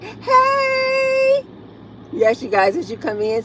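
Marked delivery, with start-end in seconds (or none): 0.0-1.4 excited greeting. Real, not acted.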